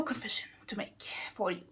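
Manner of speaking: quick hushed whisper, as if sharing a secret; confiding whisper. Real, not acted. Confessing a book secret to her viewers, whispered. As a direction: lean in and whisper it fast.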